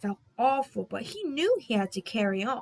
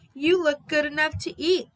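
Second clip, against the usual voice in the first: hooting tease; 5 semitones higher, 4 dB louder than usual. Acted. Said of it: "Thomas hooted".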